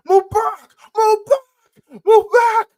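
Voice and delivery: very high, loud, panicky mock scream; imitated screaming. Host imitating a scared streamer screaming at a man, very high and loud. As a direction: ham up a terrified yell. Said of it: "Eli is, like, screaming".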